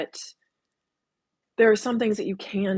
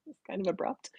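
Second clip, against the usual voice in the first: whispered aside; 9 dB quieter than usual. Real, not acted. Whispered aside after laughing.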